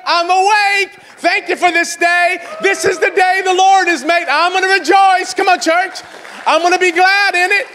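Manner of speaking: high, loud, shouted declarations to the church; roaring sermon opener; full-force preaching. Acted. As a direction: preach it out big and ringing.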